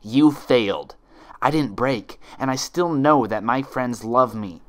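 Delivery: loud, furious defiance; defiant anger. Acted. Twilight defying the imposter, loud and angry. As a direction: throw it in her face.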